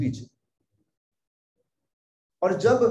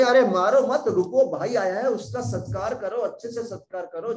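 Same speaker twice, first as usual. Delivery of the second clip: higher, louder urgent plea to stop; Parvati stopping him; 6 semitones higher, 5 dB louder than usual. Acted. Parvati stops Shiva from striking Bhrigu, urgent and loud. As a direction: call out sharply to halt a raised hand. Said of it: "बोल रही".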